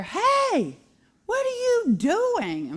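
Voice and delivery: high, drawn-out mock kid yell; imitated child's yelling. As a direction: mimic a bossy little girl yelling, voice way up. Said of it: "she's always yelling at people".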